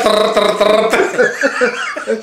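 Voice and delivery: rolled trrr like machine-gun fire; laughing mouth-made machine-gun roll; machine-gun drum. Retold or imitated. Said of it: "de ametralladora".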